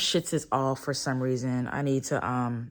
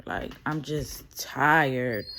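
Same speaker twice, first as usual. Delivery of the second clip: ordinary talk, nothing marked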